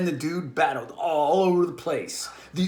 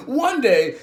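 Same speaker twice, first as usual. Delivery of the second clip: normal talking voice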